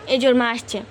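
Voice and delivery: ordinary talk, nothing marked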